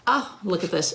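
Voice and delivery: ordinary delivery, nothing marked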